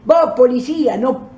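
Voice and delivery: lightly voiced man calling out to stop; retold calling out. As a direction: hold someone back from across the street. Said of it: "el hombre que me estaba gritando dice".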